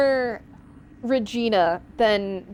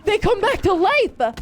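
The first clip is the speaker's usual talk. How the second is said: shocked they revive; real alarmed exclaim; 9 semitones higher than usual. Real, not acted. Game enemies revive, startled.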